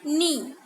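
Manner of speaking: नी with the k silent, said "nee". Shown as a demonstration. Teacher showing the class how to say नी. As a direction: say नी, dropping the k sound. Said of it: "इसे क्नी नहीं read किया जाता".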